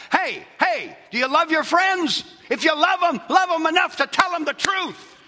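high, loud pulpit call; preaching shout. Real, not acted. Pastor challenging listeners about homosexual friends, shouting.

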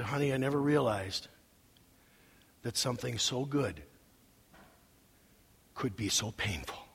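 quiet, tender words to his wife at the end of the driveway; tender confession. Retold or imitated. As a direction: confide it gently to your wife.

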